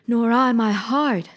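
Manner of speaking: slow, sorrowful Tin Woodman. A narrator's reading. Tin Woodman answers the Scarecrow, slow and wistful. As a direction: drag it out, longing for a heart.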